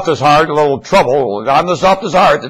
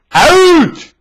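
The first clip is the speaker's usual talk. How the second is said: shouting out; 11 semitones higher than usual. Acted. Ordering staff out.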